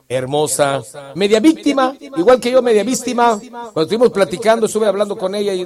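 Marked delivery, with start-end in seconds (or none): none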